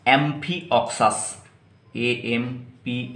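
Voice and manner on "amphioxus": Amphioxus said slowly, syllable by syllable; slow pronunciation. Shown as a demonstration. Teacher slowly pronouncing Amphioxus for students. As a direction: spell out Amphioxus slowly for the class. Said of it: "जिसका नाम है".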